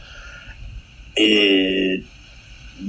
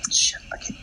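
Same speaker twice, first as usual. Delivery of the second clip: soft unvoiced mutter; whispered curse; 8 dB quieter than usual. Real, not acted. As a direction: breathe it out to yourself. Muttering, forgetting name.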